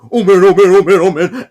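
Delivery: loud, high rush of dismay; hammed-up fretting on a loop; mock panic. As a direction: imitate someone panicking, loudly. Character panics in retold scene.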